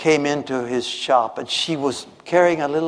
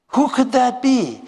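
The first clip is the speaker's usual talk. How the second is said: higher, slow puzzled question; wondering aloud; 8 semitones higher than usual. Acted. Shoemaker puzzles over the night voice, slow and wondering. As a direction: ask it slowly, higher, full of wonder.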